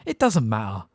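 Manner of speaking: usual speaking voice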